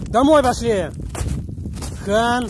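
loud, high call into the wind; shouting over wind. Real, not acted. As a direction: yell so the dog hears through wind. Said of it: "Ветер задувает, не слышно".

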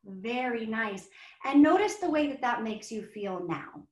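put-on gentle guiding voice for a kids' yoga class; guided calming. Shown as a demonstration. Guides children through painting calm.

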